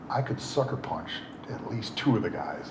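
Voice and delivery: barely voiced, breathy whisper of a violent thought. Real, not acted. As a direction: whisper it under your breath as if plotting. Inner thought of fighting.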